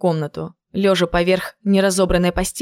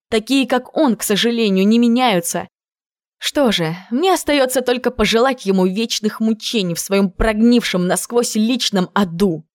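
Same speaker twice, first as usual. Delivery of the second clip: plain reading voice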